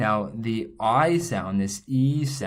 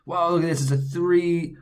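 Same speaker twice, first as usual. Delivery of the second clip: high, louder playful wow; mock surprise; 6 semitones higher, 5 dB louder than usual. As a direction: play up the surprise at a three-syllable word. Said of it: "mock surprise at a long word".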